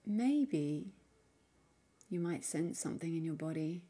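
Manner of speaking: soft, unhurried meditation voice with long pauses; guided meditation. Acted. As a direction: gently guide the listener inward, leaving space between phrases. Leads a guided mindfulness practice.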